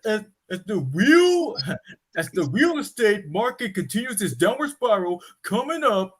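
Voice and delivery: high, loud breaking news announcer; news anchor. Acted. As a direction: announce the headline with urgency.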